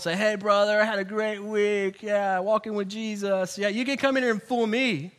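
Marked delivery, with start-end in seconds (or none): 0.2-3.6 fake-pleasant churchgoer greeting in an even, held pitch; glib, falsely fine small talk; plastic smile. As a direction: put on a Sunday-morning smile voice. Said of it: "with your plastic smile".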